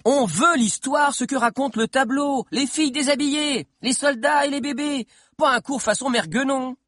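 high, insistent heckling; impatient girl calling from the crowd; crying out demands. A narrator's reading. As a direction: demand the juicy part, pitched right up. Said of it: "cria Steve".

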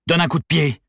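high, forceful command; ordering. A narrator's reading. Gunman ordering hostage to kick door, forceful command. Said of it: "ordonna Valérino".

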